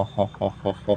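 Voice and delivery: ordinary talk, nothing marked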